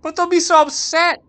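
put-on high, loud upset girl voice; pleading young girl. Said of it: "said Marie".

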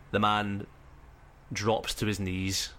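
usual speaking voice

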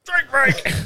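loud high shout; shouted call. Pretend. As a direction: yell it out. Echoing drink break call.

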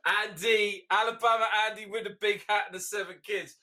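loud, high mock call. Calling out to Alabama Andy, loud mock accusation. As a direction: shout across to him teasingly.

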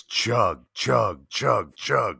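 loud chug chant; frat bro. Acted. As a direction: chant it like a party crowd. Drinking chant, louder.

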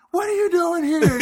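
high, loud, surprised "what are you doing here"; surprised buddy. Retold or imitated. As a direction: play the stunned friend spotting his buddy. Buddy surprised to see friend.

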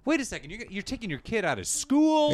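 high, disbelieving protest; incredulous parent. Acted. Host as a parent objecting to the ending, high incredulity. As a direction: challenge them, voice shooting up. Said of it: "the parent of me going".

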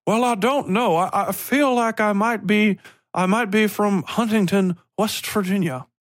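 high, drawling fake West Virginia accent; exaggerated, badly faked West Virginia accent; mock West Virginia accent. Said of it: "those fucking accents".

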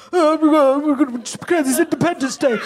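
lightly put-on mock politician; mocking politician impression; Nigel Farage. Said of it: "He doesn't even talk like that".

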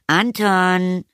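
high, drawn-out call to her son; mother calling out. A narrator's reading. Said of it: "rief die Mutter".

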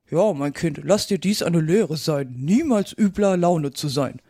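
put-on old toad's moralizing voice; old mother toad. A narrator's reading. Old toad lectures her daughter, admonishing. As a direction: lecture a child as an old croaker. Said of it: "eine alte Unke nahm die Gelegenheit wahr".

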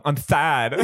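"sad" said as "Thad"; laughing put-on lisp on "Thad". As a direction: imitate a lisping kid, "Thad" for sad. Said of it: "he had a lisp".

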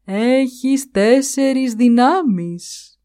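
the great tree calmly answering the buffalo, slow and serene. A narrator's reading. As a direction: speak slowly, completely untroubled. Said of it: "είπε ήρεμα το δέντρο".

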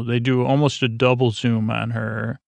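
normal talking voice

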